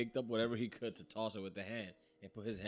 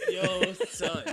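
high, loud, through real laughter; cracked up and calling out; laughing exclamation; 7 semitones higher, 7 dB louder than usual. Real, not acted. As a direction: burst out laughing on the words. Teller laughing at the sneeze joke.

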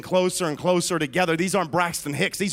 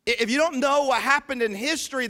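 usual speaking voice

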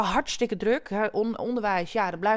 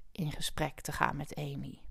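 whisper; 7 semitones lower, 12 dB quieter than usual. Acted. Lowered voice for emphasis.

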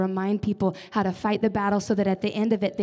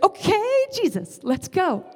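eager retold reply; 9 semitones higher, 4 dB louder, slower than usual. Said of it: "And I was like".

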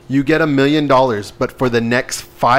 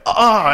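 pained ah; 9 semitones higher, 3 dB louder than usual. Pretend. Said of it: "you're like".

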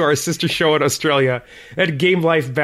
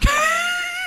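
high burst of laughter. Real, not acted. Laughs at mom's AF joke.

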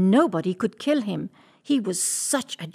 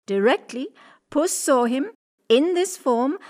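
ordinary delivery

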